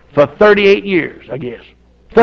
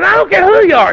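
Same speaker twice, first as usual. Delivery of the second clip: insistent; 12 semitones higher, 6 dB louder than usual. Retold or imitated. Preacher pleading with murderer.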